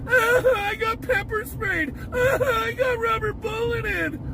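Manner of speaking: high mock crying; mimicking protesters wailing after pepper spray; mocking whine. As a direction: whimper it like a crybaby. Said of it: "laughs mocking protesters".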